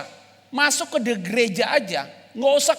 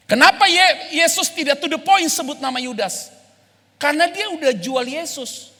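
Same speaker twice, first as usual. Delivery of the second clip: ordinary talk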